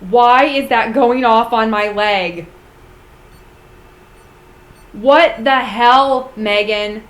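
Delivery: loud, startled and spooked; real fright, loud and slow; alarmed shout. Real, not acted. Device goes off on her leg.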